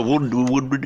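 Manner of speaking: "wood" with no L at all; silent L. Shown as a demonstration. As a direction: say "wood" like timber, L left out. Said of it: "don't pronounce the L".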